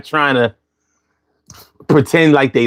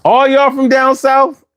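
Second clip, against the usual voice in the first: much higher, drawn-out incredulous question; can't believe everyone is from the South; amazed disbelief; 11 semitones higher than usual. Real, not acted. Reacting to many southern accents.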